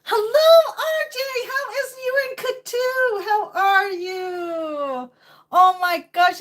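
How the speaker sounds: high, loud, thrilled hello; excited greeting. Real, not acted. Greeting friend on a call excitedly.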